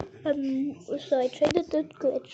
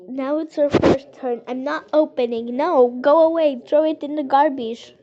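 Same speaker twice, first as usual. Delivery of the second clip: yelling at sister; 8 dB louder than usual. Real, not acted. Shooing sister with lollipop away.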